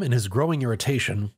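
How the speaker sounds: plain reading voice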